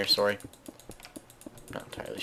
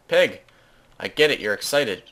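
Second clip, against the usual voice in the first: loud, higher shout of a name, then loud scolding; calling out sharply; 4 semitones higher, 9 dB louder than usual. Real, not acted. Player scolding excited Peg to leave, calling out. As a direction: tell off an overexcited pet, loudly.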